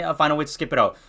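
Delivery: usual speaking voice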